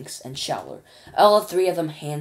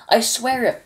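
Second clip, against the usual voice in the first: cried; 7 semitones higher, 7 dB louder than usual. Acted. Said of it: "cried poor Shuckworth".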